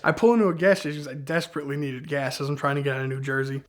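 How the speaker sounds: ordinary talk, nothing marked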